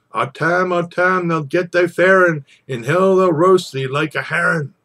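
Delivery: loud, high cry of alarm; urgent warning shout. Acted. Narrator crying a warning to Tam, loud and high. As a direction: call out to Tam as the witches close in.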